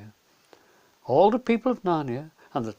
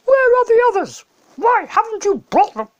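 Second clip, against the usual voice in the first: shrieking high, much louder furious witch; angry witch; 11 dB louder than usual. Acted. Witch screams at Edmund for coming alone, shrieking. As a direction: screech it at him in fury. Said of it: "is very angry".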